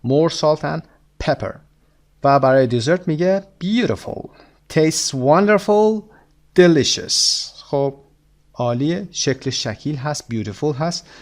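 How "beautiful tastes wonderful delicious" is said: slow model reading. Shown as a demonstration. Teacher models English phrase.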